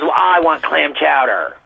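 slow, entitled snooty request; snotty demand. Retold or imitated. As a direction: drag it out like a spoiled customer. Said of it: "this snotty attitude".

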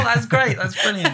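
high, laughing delight; excited. Retold or imitated. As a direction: beam it back, delighted. Her mock-delighted reply.

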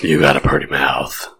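low purring come-on voice. Pretend. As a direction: purr it out like a creep. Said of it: "That's, that's my purr voice".

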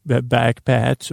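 usual speaking voice

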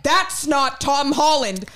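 high, slow mock outcry; retold theater outcry. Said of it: "The loudest I've ever been".

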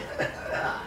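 soft maniacal cackle; crazed laugh. Acted. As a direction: cackle like a madman. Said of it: "laughing like a crazed man".

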